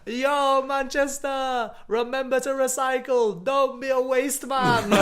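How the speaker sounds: high, drawn-out mock announcement; calling out. Podcaster telling Manchester to recycle, high calling-out voice. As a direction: hail the city in a hammed-up high voice. Repeats favourite slogan to Manchester.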